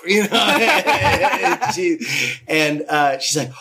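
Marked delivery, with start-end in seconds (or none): 0.3-1.4 burst of laughter. Real, not acted. Laughing at own story.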